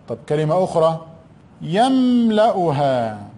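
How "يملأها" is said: يملأها stretched slowly; slow dictation. Shown as a demonstration. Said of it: "teacher dictating slowly".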